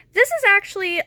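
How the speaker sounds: This IS actually, with IS hit high and loud; emphatic reveal. Real, not acted. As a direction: land hard on IS as a correction. Revealing who the figure is.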